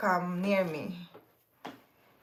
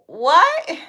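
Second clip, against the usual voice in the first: loud, high incredulous what; surprised what; 6 semitones higher, 8 dB louder than usual. Real, not acted. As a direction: react in disbelief, pitch jumping up. Reacting to a read comment.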